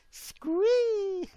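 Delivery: very high, loud hammed-up squee; mock fan squeal. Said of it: "he said".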